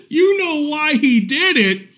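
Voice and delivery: slow high disbelieving question; incredulous. Real, not acted. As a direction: draw it out in disbelief. Incredulous at her excuse.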